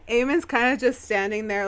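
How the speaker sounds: ordinary talk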